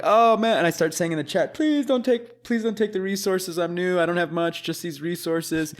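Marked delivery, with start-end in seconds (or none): 0.0-0.6 louder, higher dismayed groan; mock dismay. Retelling his dismayed reaction in game. 1.5-5.7 mock begging, starting high-pitched; pleading. Retelling his pleading in game chat.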